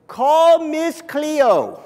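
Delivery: drawn-out, high mock Jamaican hotline call; Miss Cleo. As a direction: put on a loud TV psychic's Jamaican accent, stretching the words. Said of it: "she was supposedly Jamaican".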